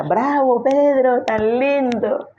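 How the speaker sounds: high, gushing mock praise; mock applause. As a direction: cheer him on like a proud fan, hammed up. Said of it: "que Jesús le aplaudiera".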